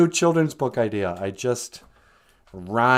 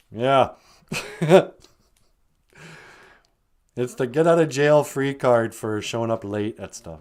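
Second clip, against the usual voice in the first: ordinary delivery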